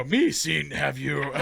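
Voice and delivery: high, loud backwards Yoda talk. Acted. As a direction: stumble through Yoda-speak, slow at the end. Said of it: "trying to talk backwards".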